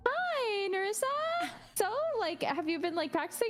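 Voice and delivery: high, singsong imitation of a teacher. Imitating a voice teacher asking a pupil about practice, high-pitched. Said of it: "And so she's like".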